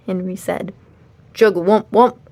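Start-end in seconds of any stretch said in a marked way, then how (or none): 1.3-2.2 loud croaking voice; frog croak. A narrator's reading. The Frog King answers Henry with a croak. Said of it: "the Frog King answered".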